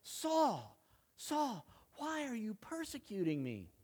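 high plaintive call, softening into the question; voice from heaven. Acted. Voice from heaven calls to Saul on the road, high and imploring. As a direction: call out high, each name falling away. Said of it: "A voice speaks out of heaven".

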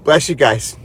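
low, subdued sign-off; emotional, near tears. Real, not acted. Host blesses viewers and says good night, choked up. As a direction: say goodbye low, holding back tears. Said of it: "I'm emotional".

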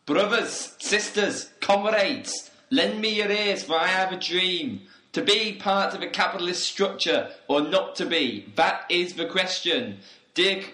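rally the comrades from a soapbox; pompous revolutionary address; rousing speech. Acted.

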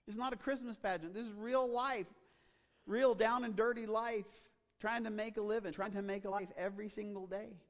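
high, loud, driving preacher voice; full-force preaching. Real, not acted. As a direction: preach it out with urgency.

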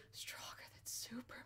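very soft, voiceless mimicry; imitated whisper. Stranger muttering as the door handle turns, whispered. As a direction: whisper it like the weird man in the next seat. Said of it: "And he's just whispering".

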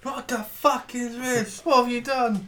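high, slow panicked cry. Acted. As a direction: shriek it in terror. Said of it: "They seem to start panicking".

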